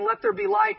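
high, light throwaway command; a flippant wave-of-the-wand God; lightly mocking the idea; mock casual God. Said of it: "God saying".

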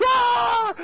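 very high, loud cheering scream; wild mock crowd roar. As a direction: imitate a stadium crowd erupting. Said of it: "and the crowd goes".

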